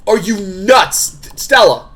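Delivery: high, loud, slow disbelief; exasperated shout. Acted. Character confronting Stella in danger, exasperated shout.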